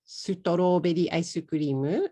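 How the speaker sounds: "sutoroberi ice cream" ending aisu-kurimu with rising tune; katakana English. Pretend. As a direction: order "sutoroberi ice cream" with extra Japanese vowels. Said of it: "ask the waiter for".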